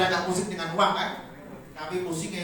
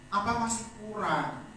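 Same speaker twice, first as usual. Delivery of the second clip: ordinary talk, nothing marked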